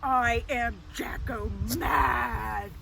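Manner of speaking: loud, slow, hammed-up anger; pretend angry voice. Shown as a demonstration. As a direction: growl it out like a mad pumpkin. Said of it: "You say that in an angry voice".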